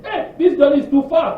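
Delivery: high, loud whiny moan with a laugh; mock complaining. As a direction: ham up a grumbling traveller. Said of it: "Com-, complain".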